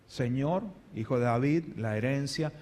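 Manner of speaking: low, soft, unimpressed asking; quiet, casual plea. Acted. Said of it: "le hablaba como un ser humano".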